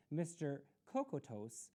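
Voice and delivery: usual speaking voice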